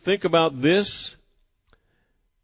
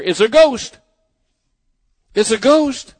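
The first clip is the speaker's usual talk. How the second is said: raised, louder frightened cry of the disciples; fearful cry; 6 semitones higher, 6 dB louder than usual. Acted. Disciples crying out in fear, higher and louder. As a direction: cry out as if seeing a ghost on the water. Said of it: "they cried out in fear".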